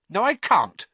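high, loud, irritated refusal; snapping. A narrator's reading. Hermione turns down the boys' offer, snapping. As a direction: snap the refusal back at him. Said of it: "snapped Hermione".

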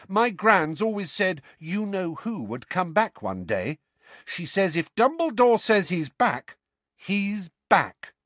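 high-pitched, earnest Neville-like voice; earnest boy. A narrator's reading. Neville quotes his gran to back Harry, earnest and high. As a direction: insist on it in a high, sincere voice.

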